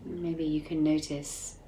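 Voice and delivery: soft, unhurried meditation voice with long pauses; guided meditation. Acted. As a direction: guide listeners inward gently, leaving space between phrases.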